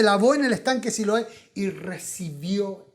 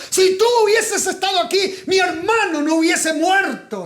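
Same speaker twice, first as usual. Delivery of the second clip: reproche gritado, agudo y fuerte; reclamo con bronca; 7 semitones higher, 4 dB louder than usual. Acted. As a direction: Marta le grita su enojo a Jesús. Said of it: "la bronca de que el hermano había muerto". (Marta reclama a Jesús por su hermano muerto, gritando)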